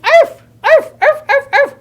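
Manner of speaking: high, loud, quick yaps; excited high arfing; dog bark. Acted. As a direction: bark like a happy little dog. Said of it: "And he went".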